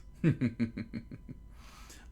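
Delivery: real voiceless snicker, trailing away; breathy chuckle. Real, not acted. Reader finishing chapter one, breathy trailing chuckle.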